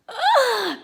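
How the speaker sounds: high, loud fake shocked gasp; mock gasp. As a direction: gasp in pretend horror. Sarcastic pity for Brenda.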